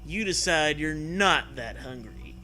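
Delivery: usual speaking voice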